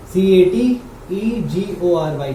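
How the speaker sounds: slow letter-by-letter spelling of सीएटीईजीओआरवाई. Shown as a demonstration. Teacher spelling the word for students, slow and even. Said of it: "category".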